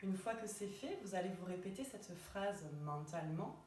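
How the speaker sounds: unhurried relaxation voice with long pauses; guided meditation. Acted. Yoga teacher guiding listeners lying in Shavasana, calm guided meditation. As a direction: guide them gently into rest, phrase by phrase.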